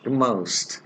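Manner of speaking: "most" with an ow glide, like "moust"; British-American pronunciation. Shown as a demonstration. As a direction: say "most" to rhyme with "oust". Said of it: "they pronounce the words".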